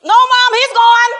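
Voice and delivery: put-on high, loud teen voice; daughter's blunt reply. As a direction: toss it off like a careless kid. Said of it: "She was like".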